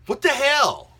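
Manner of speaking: high, loud What the hell; disbelieving outburst, voice jumping up; exasperated. Real, not acted. Vlogger baffled by fans hating unseen films, exasperated.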